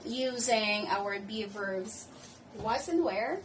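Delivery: ordinary talk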